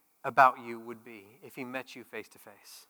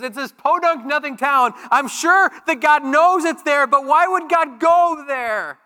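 high, loud, slow scoffing voice; scornful Nathanael; 11 semitones higher, 11 dB louder, slower than usual. Acted. Nathanael mocking Nazareth to Philip, high loud scoff. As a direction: sneer at the nothing town, drawing it out. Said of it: "He goes".

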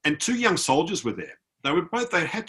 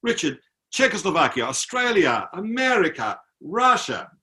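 higher, earnest roll call; imitating emotional soldier; 7 semitones higher than usual. Soldier lists countries to unite, imitated emotion. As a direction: proclaim each country as a heartfelt appeal. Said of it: "he got quite emotional".

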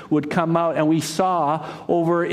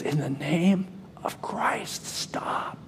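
slow, breathless whisper of last words; faint whisper, fading away on stop; dying whisper; 2 semitones higher, 8 dB quieter, slower than usual. Acted. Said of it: "a whisper could be heard".

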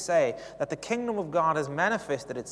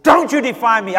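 loud, high rebuke of the wind; angry command; 8 semitones higher, 18 dB louder than usual. Retold or imitated. Preacher rebuking the storm in anger, loud and high. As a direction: scold the storm with full force. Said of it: "I was angry. I said".

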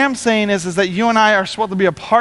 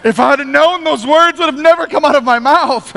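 higher-pitched flustered mock apology; hammed-up embarrassed excuse; imitating mortified apology; 5 semitones higher, 3 dB louder than usual. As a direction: backpedal as an embarrassed swearer. Swearers apologise to the pastor.

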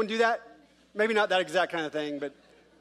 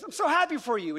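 higher, sugary fake-happy voice; fake congratulations; 8 semitones higher, 4 dB louder than usual. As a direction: congratulate while not meaning it. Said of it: "we say all the right things".

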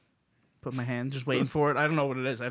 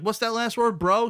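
mock confused lady; 8 semitones higher, 5 dB louder than usual. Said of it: "She's like".

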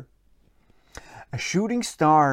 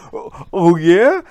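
groggy cat startled awake, louder; sleepy surprised cat voice; sleepy exclaim; 8 dB louder than usual. Acted. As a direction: wake with a start. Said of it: "he exclaimed sleepily".

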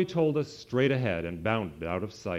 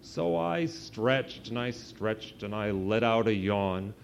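ordinary delivery, nothing marked

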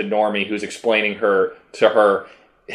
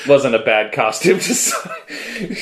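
slow start, cracking up toward the end; laughing through words; 3 semitones higher, slower than usual. Real, not acted. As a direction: try to finish the sentence while laughing. Cracking up while defending costume.

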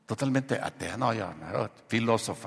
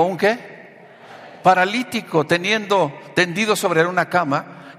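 raised, forceful Bible reading; preacher's scripture reading; 6 semitones higher, 7 dB louder than usual. Real, not acted. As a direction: read it out ringingly to the congregation. Reads scripture aloud to congregation.

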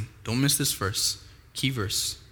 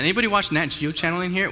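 raised pitch, rallying question; livelier second ask; 5 semitones higher, faster than usual. Real, not acted. Preacher giving the audience another chance to answer, livelier and higher. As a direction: ask it brightly to rouse the room. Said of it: "I'll give you guys another chance".

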